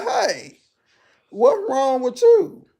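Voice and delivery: high, loud, slow call. Retold or imitated. Shouting to the slumped lady, high and loud. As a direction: call out to get her answer. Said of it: "I said".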